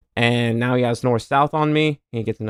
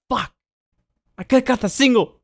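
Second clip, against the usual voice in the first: pissed; 10 semitones higher, 3 dB louder than usual. Retold or imitated. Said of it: "I was so pissed".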